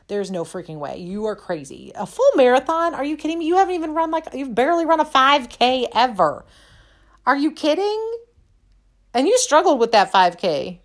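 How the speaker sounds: high, loud, scoffing disbelief; the doubting voice in her head, high and loud with scorn; mocking inner voice. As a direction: sneer at your own crazy idea. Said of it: "negative thoughts in my head were telling me".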